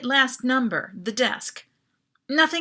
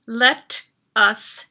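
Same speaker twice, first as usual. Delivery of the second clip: slow, louder, teeth clenched; through clenched teeth; 8 dB louder, much slower than usual. A narrator's reading. Karthik on the com demanding the clerk release them, through clenched teeth. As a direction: force it out past clenched teeth. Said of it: "through clenched teeth".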